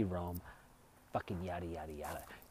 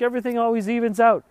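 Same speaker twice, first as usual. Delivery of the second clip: imitating Jerry; 11 semitones higher, 11 dB louder than usual. Jerry's line voiced.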